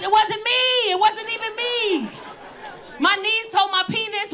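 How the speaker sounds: ordinary talk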